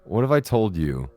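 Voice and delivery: ordinary talk, nothing marked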